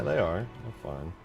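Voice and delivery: usual speaking voice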